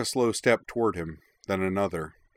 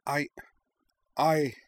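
higher, nervous broken start; stammering; 7 semitones higher than usual. A narrator's reading. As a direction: stumble on the first word in panic. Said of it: "Nima stammered".

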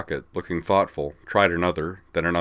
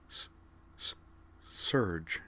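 halting, hushed stammer; quiet, tentative wonder; soft; 10 dB quieter, much slower than usual. A narrator's reading. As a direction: stumble softly on the first sound. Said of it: "said Caitlin softly".

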